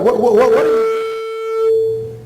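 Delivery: high, slow stammering protest; flustered son. Retold or imitated. Grown son sputtering high at his mother emptying the cupboards.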